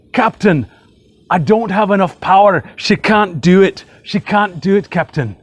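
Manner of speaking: hammed-up Scotty begging the captain, Scottish-Irish muddle; Scotty accent. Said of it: "my best, uh, Scotty accent from Star Trek".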